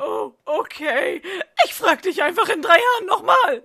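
mock crying, high and loud; hammed-up whimper. Rejected Neville whimpering to Ron, mock crying. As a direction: blubber it out, hurt.

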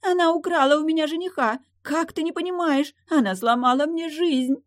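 put-on very high-pitched girlish wail; hysterical protest. A narrator's reading. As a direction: wail at your father about a ruined life. Marquise protests hysterically to her father.

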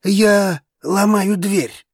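slow, high, emphatic threat; drawn-out, forceful warning; final warning. A narrator's reading. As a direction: announce it one weighty word at a time. Announcing he will break the door.

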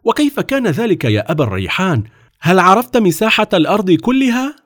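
high-pitched, wondering questions; eager. A narrator's reading. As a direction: ask with keen curiosity, climbing at the end. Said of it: "وهتف السلطان في شوق".

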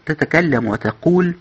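ordinary delivery, nothing marked

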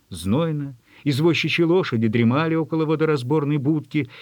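usual speaking voice

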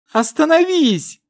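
crying shout. A narrator's reading. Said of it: "расплакался тринадцатилетний Кирос".

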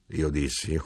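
ordinary delivery

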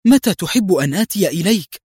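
excited exclaim. A narrator's reading. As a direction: burst out, ready to go. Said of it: "هتف قدري في انفعال".

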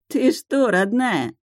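feigned sweet astonishment; мило изумлённо. A narrator's reading. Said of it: "мило изумился он". (притворно-милое изумление)

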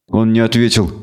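ordinary delivery

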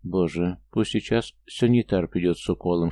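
plain reading voice

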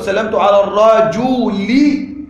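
الرجل drawn out with a long sounded -i, ar-rajuli; pronounced kasra. Shown as a demonstration. Teacher comparing endings for students, الرجل slowly as ar-rajuli. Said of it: "كسرة".

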